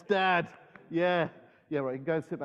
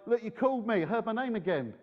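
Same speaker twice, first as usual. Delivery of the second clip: higher-pitched, lightly acted insistence; a hint of an insistent boy, pitched up; Samuel, lightly voiced; 8 semitones higher than usual. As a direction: mildly protest as the woken boy. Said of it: "he goes back to Eli".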